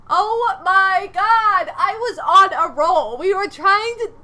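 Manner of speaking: laughing in loud disbelief; loud exasperation. Real, not acted. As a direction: cry out that the run is ruined. Reacting to ruined game.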